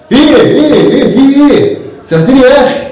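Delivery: playing the son, defensive and fed up; annoyed son.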